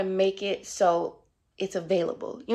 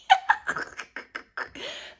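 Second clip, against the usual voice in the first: laugh. Real, not acted. Laughs off the emotion.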